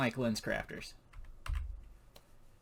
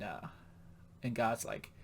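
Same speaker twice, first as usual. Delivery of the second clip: usual speaking voice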